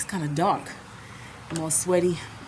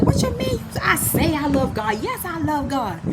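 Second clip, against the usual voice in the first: offended; 6 semitones higher than usual. Retold or imitated. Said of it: "I'm getting offended now".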